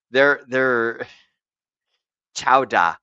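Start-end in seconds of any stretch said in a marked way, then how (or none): 2.4-3.0 put-on loud Boston accent, dropped R's; hammed-up Boston greeting in local accent. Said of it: "the fucking Boston accent is wonderful".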